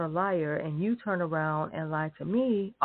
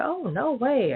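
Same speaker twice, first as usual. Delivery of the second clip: mock denial; 7 semitones higher, slower than usual. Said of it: "liar denying, retold".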